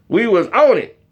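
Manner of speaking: usual speaking voice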